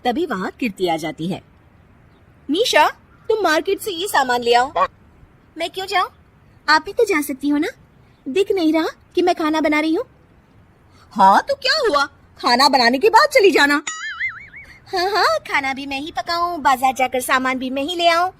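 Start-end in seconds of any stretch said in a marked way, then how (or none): 2.5-4.7 high, ordering tone; bossy sister-in-law. Acted. Kirti orders Nisha. 5.6-18.3 high, sarcastic refusal; defiant mocking retort.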